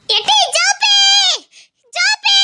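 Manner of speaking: high loud call of the name. Acted. Old woman calling Soppi out of the house. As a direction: call her name sharply.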